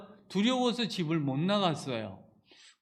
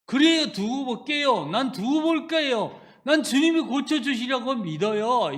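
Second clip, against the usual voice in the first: defiant faith; 5 semitones higher, 6 dB louder than usual. Retold or imitated. Defiant answer of faith to God.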